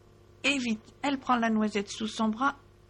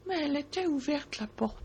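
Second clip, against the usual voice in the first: ordinary delivery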